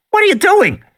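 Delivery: put-on high loud frightened child; panicked girl. A narrator's reading. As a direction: cry out in alarm. Voicing Lex.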